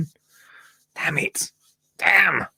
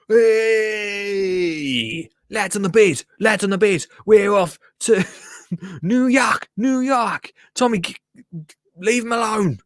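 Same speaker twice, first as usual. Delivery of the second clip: rowdy jeering pub banter; 6 semitones higher than usual. Pretend. Cheering the lads on screen.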